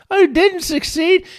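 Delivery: high, crestfallen disbelief; dismayed returned soul. Acted. Returned Matt reacts to failure.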